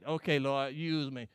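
mock the easy believer; imitating a lazy churchgoer praying; lazy mock prayer. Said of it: "and that's it".

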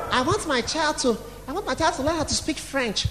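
softly spoken, mock-proud parent; understated parent's wish. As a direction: say it quietly, playing the parent. Plays a proud parent's excuse.

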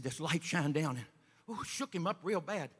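usual speaking voice

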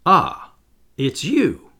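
mildly astonished outburst; surprised cry. A narrator's reading. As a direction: cry out as if just noticing a visitor. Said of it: "he cried at last".

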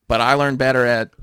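usual speaking voice

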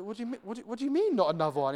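rising, disbelieving question, pitch jumping up; incredulous. Retold or imitated. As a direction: act baffled and a bit offended. Surprised retort in coffee story.

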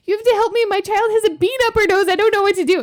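very high, fast mock frantic plea; frantic mom on phone. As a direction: beg the nurse for help. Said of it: "was like".

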